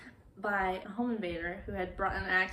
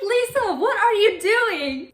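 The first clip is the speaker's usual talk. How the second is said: much higher, loud, drawn-out incredulity; mock self-scolding; 10 semitones higher, 9 dB louder than usual. Lisa catching herself chatting to the monster, mock appalled. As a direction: squeal it like you're appalled.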